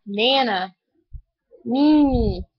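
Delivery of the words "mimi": normal talking voice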